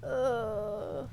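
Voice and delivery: usual speaking voice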